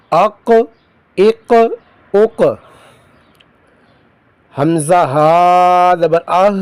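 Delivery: slow, sing-song spelling chant; Qaida recitation drill. Shown as a demonstration. Teacher drilling pupils through Qaida spelling, slow sing-song chant. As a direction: call out each syllable slowly for pupils to repeat.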